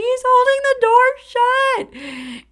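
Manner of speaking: very high, drawn-out reenacted scream. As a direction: shriek it in panic down the phone. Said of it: "I'm screaming".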